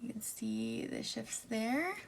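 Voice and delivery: soft breathy whisper. Real, not acted. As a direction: hush it while showing the shift. Whispered swatch commentary.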